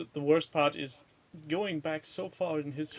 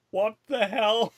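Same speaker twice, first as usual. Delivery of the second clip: baffled bird; 7 semitones higher, 8 dB louder, slower than usual. Pretend. Voicing the vulture's baffled look.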